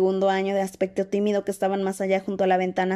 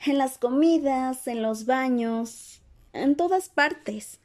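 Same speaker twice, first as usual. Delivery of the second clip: slow, higher-voiced drawn-out list; calm listing; 5 semitones higher, much slower than usual. A narrator's reading. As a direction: count them off slowly, unruffled. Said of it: "respondió Hermione con toda tranquilidad".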